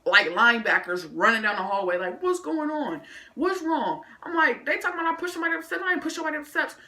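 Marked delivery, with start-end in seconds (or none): 2.2-4.0 high-pitched mimicked teachers; raised-pitch retold concern; alarmed teachers. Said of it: "So the teachers". 4.6-6.8 high, rushed retold protest; rapid, high-pitched self-defence; protesting innocence. Acted protest to the teachers.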